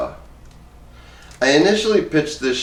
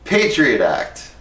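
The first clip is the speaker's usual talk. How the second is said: ordinary delivery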